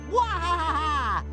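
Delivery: hammed-up "wahahaha" cackle; evil villain laugh. Villain gloats over his plan.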